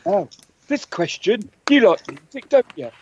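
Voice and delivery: ordinary delivery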